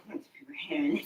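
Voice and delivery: quick hushed whisper. Real, not acted. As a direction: whisper fast so nobody hears. Whispered aside about listeners.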